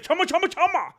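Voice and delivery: very high, rapid mock babble; Alex Pereira impression. Streamer mimics fighter answering a baffled man, high mock voice. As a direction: squeak it like Sims gibberish. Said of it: "sounding like one of those characters from The Sims".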